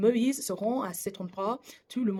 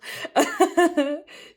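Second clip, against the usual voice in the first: a short self-conscious laugh, higher and louder; embarrassed laugh; 8 semitones higher, 7 dB louder than usual. Real, not acted. Laughing at his clumsy French to the viewers. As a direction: laugh off his own mistakes.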